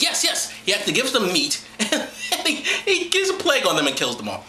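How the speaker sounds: high-pitched, incredulous giggling through the words; laughing. Real, not acted. Host mocking the plague story, laughing as he talks. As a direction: barely get it out for laughing.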